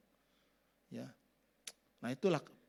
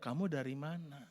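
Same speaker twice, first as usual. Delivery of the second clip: soft, lower, calm question; calm asking; 3 semitones lower, 3 dB quieter than usual. Shown as a demonstration. Preacher contrasting the calm version of the question, soft. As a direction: ask it quietly and kindly.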